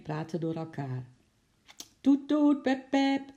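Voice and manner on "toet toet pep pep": high, piercing loud horn imitation; fiercer impatient honking. A narrator's reading. Long row of waiting cars, fierce impatient honking. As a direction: honk insistently, held on one high note. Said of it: "Steeds feller en ongeduldiger klonk het getoeter".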